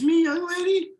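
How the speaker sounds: mock-shocked dad reprimand; stern parent. Father scolds disobedient daughter.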